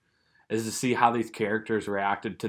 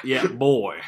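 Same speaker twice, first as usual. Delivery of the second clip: mock Southern drawl; playful Southern imitation; Southern accent; 3 dB louder than usual. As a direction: echo the word in Southern style. Echoes the Southern impression.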